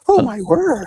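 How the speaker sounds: high prim scandalized lady voice; pearl-clutching lady. Retold or imitated. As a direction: gasp like a shocked church lady. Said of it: "clutching her pearls, going".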